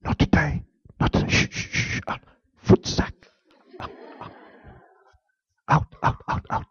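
whispered firm shooing; hushed dismissal. Retold or imitated. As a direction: send the dog out quietly. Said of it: "you could tell the guy".

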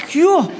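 exasperated phew. Retold or imitated. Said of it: "And I'm like".

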